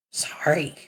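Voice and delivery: breathy whispered sorry; hushed sheepish apology. Acted. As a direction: whisper it meekly. After being told to shut up.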